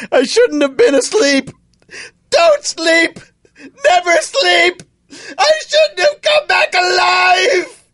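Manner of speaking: sobbing, rising into shouts; crying breakdown. Acted. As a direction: voice high and breaking. Dad's war trauma breakdown.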